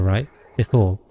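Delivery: normal talking voice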